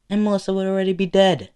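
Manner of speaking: high, loud, rushed protest; heated outburst. A narrator's reading. Izuku arguing with Bakugo about the fall, heated. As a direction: burst out with the worst consequence. Acted confrontation, driving point home.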